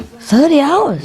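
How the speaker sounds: slow, high, astonished question; shocked question. Real, not acted. Comic reacting to a thirty-hour flight, astonished. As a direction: draw it out in disbelief.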